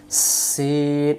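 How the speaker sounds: সিন with a long hissing s held before the vowel; hissing s sound. Shown as a demonstration. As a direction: stretch the s of সিন into a long hiss. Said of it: "এমন শব্দ হবে". (শিস দিয়ে টানা সিন)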